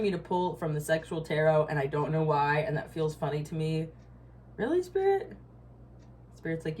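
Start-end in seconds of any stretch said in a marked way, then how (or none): 4.6-5.3 much higher disbelieving question; incredulous. Real, not acted. To Spirit about the sexual tarot, high incredulous. Surprised by card pull.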